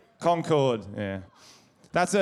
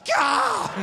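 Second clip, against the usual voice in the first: sharp squawking bird cry, much higher and louder; ibis squawk; 3 dB louder than usual. Acted. Comic acts out an ibis for the crowd with a squawk. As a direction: screech like a bin-raiding ibis. Acting an ibis tipping rubbish.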